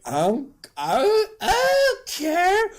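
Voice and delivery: high, drawn-out fake crying; hammed-up whimper, stretched and squeaky; mock tearful defiance. Paul told baby will die.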